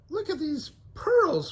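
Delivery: high excited find; pointing out a clue; Velma exclaiming. Acted. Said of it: "Velma exclaimed".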